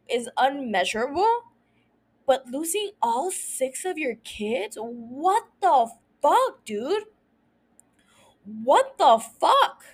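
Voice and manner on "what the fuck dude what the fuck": slow, drawn-out incredulous swearing; stunned disbelief. Real, not acted. Host reacts to losing all six kids, stunned disbelief. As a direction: stretch each word, flabbergasted.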